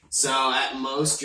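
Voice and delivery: normal talking voice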